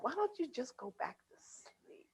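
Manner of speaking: soft unvoiced whisper. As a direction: hush them back to sleep. Soothing partner to sleep.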